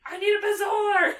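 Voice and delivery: loud, high mock shout, laughing through it; hammed-up shouted demand; urgent Harry. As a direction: yell it like a hero in a crisis. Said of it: "And Harry's like".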